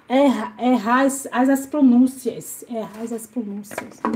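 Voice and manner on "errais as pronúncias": much softer, muttered to herself; quiet puzzled mutter. Shown as a demonstration. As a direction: mumble it under your breath, puzzled. Puzzling over a chat message.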